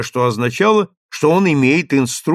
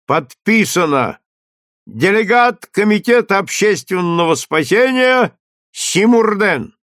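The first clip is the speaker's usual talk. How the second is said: town crier; 7 semitones higher, 4 dB louder than usual. A narrator's reading. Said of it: "Глашатый продолжал".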